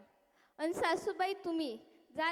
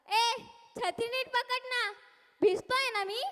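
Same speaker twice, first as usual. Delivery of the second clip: irritated snap; 6 semitones higher, 4 dB louder than usual. Acted. Politician snapping at umbrella holder.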